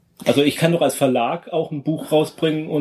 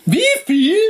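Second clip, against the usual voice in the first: high startled cry; shocked outburst; 14 semitones higher, 5 dB louder than usual. Acted. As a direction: squeak it out in disbelief. Mock community outrage.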